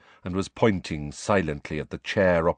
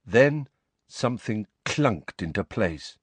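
usual speaking voice

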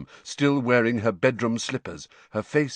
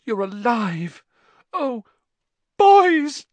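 high emotional cry, building to a loud wail; mother overcome with relief; overwrought relief; 9 dB louder, much slower than usual. A narrator's reading. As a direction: cry out to the boys with rising emotion. Overwrought mother greets her sons.

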